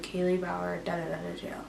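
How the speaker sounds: very soft, downcast mumble; depressed. Retold or imitated. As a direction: imitate yourself sounding depressed on a recording. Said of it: "like, depressed".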